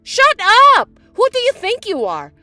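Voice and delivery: sharp, high, loud yell; angry shout. Acted. Stepsister shouting at her stepsister, furious. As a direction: snap at her to shut up.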